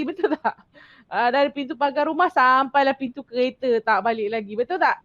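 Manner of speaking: ordinary delivery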